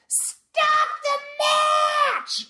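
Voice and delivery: long, very high-pitched drawn-out shriek; imitated hysterical scream, high and drawn out; blood-curdling scream. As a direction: scream it, stretching each word way out. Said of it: "this blood-curdling scream".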